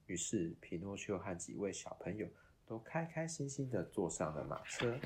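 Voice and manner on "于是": ordinary delivery, nothing marked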